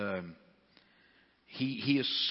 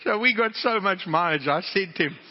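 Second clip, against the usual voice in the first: laughing; 8 semitones higher, 10 dB louder than usual. Real, not acted. Laughing after punchline.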